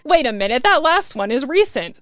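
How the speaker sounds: loud, high exclamation; yelled alarm. A narrator's reading. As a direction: call it out in sudden alarm. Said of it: "I whisper, yell".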